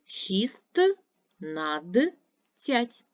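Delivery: шість над цять split into separate pauses; syllable by syllable. Shown as a demonstration. Teacher to pupils, spelling the word in syllables. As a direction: break шістнадцять into parts, one at a time. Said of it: "І правильно пиши".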